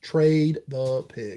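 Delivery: very slow, deliberate answer; slow, emphatic verdict. Real, not acted. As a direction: draw out each word.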